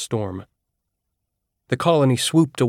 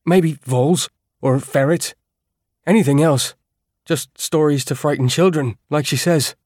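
higher, louder, puffed-up know-it-all; trying to sound authoritative; 5 semitones higher, 4 dB louder than usual. Acted. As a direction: bluff a confident dismissal. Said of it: "He was trying to sound authoritative".

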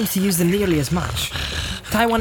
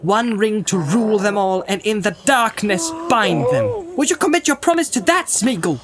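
higher-pitched, commanding rebuke; stern warning; 7 semitones higher than usual. A narrator's reading. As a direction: challenge the captive sternly, with emphatic warning. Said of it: "his words and his stern voice".

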